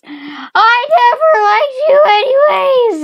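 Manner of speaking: high, loud dad outburst; hammed-up furious yell; angry scream. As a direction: scream it in a shrill pitch. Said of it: "he screams".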